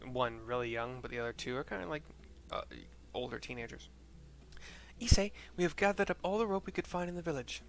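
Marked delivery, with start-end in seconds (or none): 5.0-7.6 lightly voiced girl, a touch higher; a hint of a village teen reporting; teenage girl. Streamer voicing a teenage girl to Issei, lightly higher. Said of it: "says a teenage woman".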